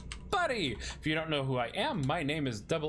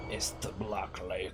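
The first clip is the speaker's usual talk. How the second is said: hushed whisper; 6 semitones lower, 3 dB quieter, slower than usual. Acted. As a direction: breathe it out in a whisper. Dramatic reveal of location.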